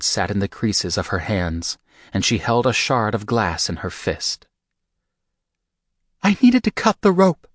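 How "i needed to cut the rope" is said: high, louder shaky female voice; Rika, voice shaking. A narrator's reading. Bound Rika tells Michael why glass broke, shaky and high. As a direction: Rika explains herself, voice shaking. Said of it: "Her voice shook".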